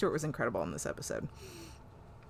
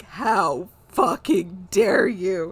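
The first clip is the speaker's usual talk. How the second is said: loud, slow, every word spat out; furious outburst; 10 dB louder, much slower than usual. Retold or imitated. Nora yelling at Picard during the hearing, loud slow fury. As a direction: hurl it at Picard in outrage. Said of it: "And then Nora's like".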